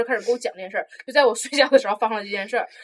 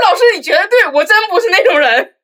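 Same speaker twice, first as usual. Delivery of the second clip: much higher, louder sarcastic agreement; mock sincere reply; 8 semitones higher, 10 dB louder than usual. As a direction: overplay agreeing with the teacher. Her sarcastic reply to teacher. (夸张讽刺地附和老师)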